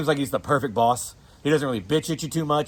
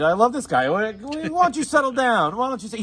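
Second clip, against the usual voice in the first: mock Tommy's mom; 9 semitones higher than usual. Quoting Tommy's mom, a doting mother fussing over her son. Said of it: "quoting Tommy's mom".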